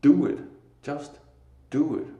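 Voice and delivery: rally the listener to act; loud urging. Real, not acted. Preacher urging viewers loudly to just do it.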